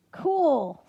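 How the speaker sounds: high, loud excited burst; the kid thinks it's awesome; thrilled. Acted. Boy thrilled by the gods.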